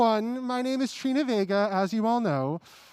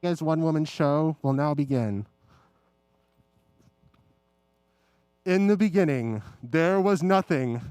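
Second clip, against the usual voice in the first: grand dramatic narration; 9 semitones lower, 3 dB quieter than usual. Acted. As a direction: intone it like an epic prologue. Dramatic opening of one-woman show.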